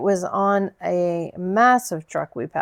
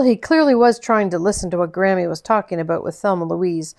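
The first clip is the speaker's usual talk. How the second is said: usual speaking voice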